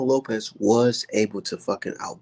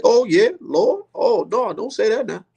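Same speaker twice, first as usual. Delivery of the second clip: Roy Jones impersonation; 11 semitones higher, 4 dB louder than usual. Retold or imitated. Streamer impersonating Roy Jones on commentary, high fretful voice. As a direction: whine it high like a worried elder. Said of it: "That's my Roy Jones impersonation".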